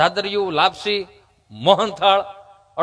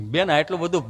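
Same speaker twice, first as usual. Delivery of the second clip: imitated halting stammer, pitched down; stammering friend; 5 semitones lower than usual. Friend telling comedian he's going for vaccine, imitated stammer. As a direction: play the friend whose tongue sticks. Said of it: "જીભ જલાઈ ચોંટે અટકે".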